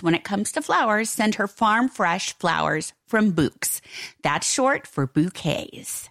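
soft, slow ad read. Acted. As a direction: gently pitch the flowers. Reads a sponsor ad.

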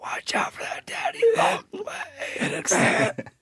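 slow high tearful ghostly whisper; soft echoing dream voice. Pretend. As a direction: sob it out like a dying ghost. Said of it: "you gotta do it all in the voice".

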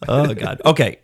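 normal talking voice

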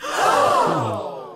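a sudden unvoiced gasp of shock; sharp gasp. Reader gasps at the letter's twist, a sharp gasp. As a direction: gasp as if the news lands hard. Reading the listener's heartbreak letter.

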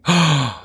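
shocked gasp. Real, not acted. Shocked at banana pudding.

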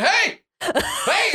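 very high mock yell; high imitation of a calling voice; imitated shout. Retelling the player's shouted call.

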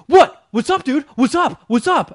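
loud, high, rapid-fire challenge; retold yelling. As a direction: mimic an aggressive guy hollering back. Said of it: "he's still, like, yelling shit at me".

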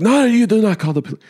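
high-pitched, urgent objection; imitated frantic protest. Husband stopping driver from calling the police, imitated frantic protest. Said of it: "he immediately calms down".

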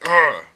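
loud frustrated ugh; frustrated groan. Retold or imitated. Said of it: "out of frustration and anger".

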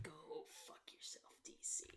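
very quiet mock curse; barely audible dismissive aside; hushed retort. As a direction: say it far under your breath. Muttered imagined retort to DC.

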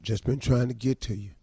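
low, evasive husband making excuses; low sheepish excuse; meek husband. Acted. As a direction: play the henpecked guy, voice dropped low. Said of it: "I'm the guy".

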